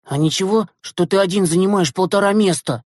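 high, pressing protest; indignant. A narrator's reading. Boy protesting at sharing his seat, indignant. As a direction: object as if crowded out. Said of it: "возмутился Глеб Елизаров".

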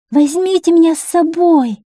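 high, loud plea of a little princess; excited, pleading shout; crying out. A narrator's reading. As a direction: call up to the birds. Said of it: "закричала она".

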